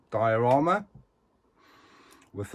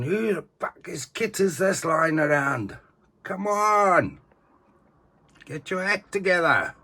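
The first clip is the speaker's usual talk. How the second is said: higher, gruff drill sergeant bark; mock sergeant shout; 7 semitones higher than usual. Collector voicing Sergeant Barnes, mock shouting at the newbies. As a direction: play the sergeant yelling at newbies.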